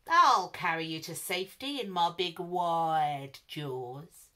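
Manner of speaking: the alligator slowly tempting the animals, a little sinister; sly alligator. A narrator's reading. Alligator offering the frightened animals a ride, slow and smooth. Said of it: "said the alligator".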